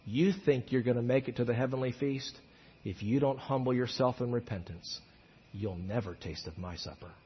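low, very soft warning; hushed rebuke. Retold or imitated. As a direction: let the warning sink to almost nothing. Grave paraphrase of rebuke.

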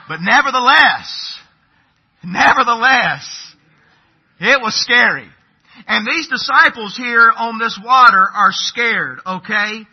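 loud, slow, high pulpit delivery; preaching at full force. Acted. Pastor moving from his story into the sermon, preaching hard. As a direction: drive the point home to the congregation.

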